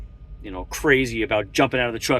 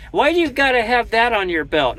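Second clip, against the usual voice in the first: mock nosy stranger; 10 semitones higher, 4 dB louder than usual. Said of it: "I've had that a million times".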